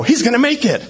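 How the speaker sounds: high-pitched retold excitement; excited realisation. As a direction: let hope lift the voice, acted lightly.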